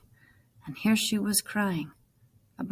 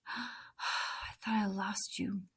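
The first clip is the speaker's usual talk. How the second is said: slow, soft whisper of relief; relieved whisper; 9 dB quieter, much slower than usual. Acted. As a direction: breathe it out slowly, overwhelmed. Reunion with lost brother.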